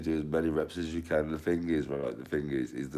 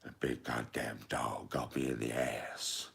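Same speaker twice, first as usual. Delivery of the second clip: lightly voiced film dad; 4 semitones lower, 4 dB quieter than usual. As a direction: do the dad, low and drawled out. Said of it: "His dad, when he's watching that".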